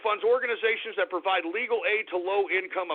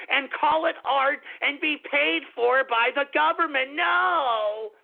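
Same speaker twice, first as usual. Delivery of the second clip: higher, whiny mock protester voice; mocking whiny leftist; 5 semitones higher, slower than usual. Mocking leftists complaining about art funding cuts, higher whiny voice. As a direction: lampoon a wailing arts-funding leftist. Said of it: "leftists that are sitting here pissing and moaning".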